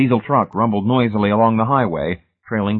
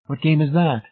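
higher-pitched, mild question; innocent, gentle query; mild inquiry; 8 semitones higher than usual. A narrator's reading. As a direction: ask it mildly, as if puzzled. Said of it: "Miss Rice inquired mildly".

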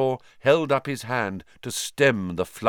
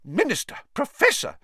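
high, sharp, indignant; angry nurse. Acted. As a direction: scold them out of the ward. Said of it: "said Madame Pumphrey angrily".

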